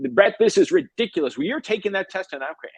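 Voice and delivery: high-pitched, exasperated mock scolding; intense mom. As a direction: imitate a mother laying down the law, voice shooting up. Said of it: "his mom was super intense".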